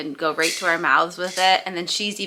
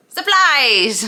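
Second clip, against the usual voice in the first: spring the punchline as a startling shout; sudden yell; 5 semitones higher, 6 dB louder than usual. Acted. Chinese man jumps out at foreman with a loud yell. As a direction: leap out and yell it, higher and louder.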